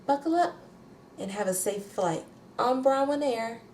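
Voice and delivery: a high, lilting cabin sign-off; sing-song the goodbye like a flight attendant; lightly mocking airline announcer. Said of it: "the little voice she did".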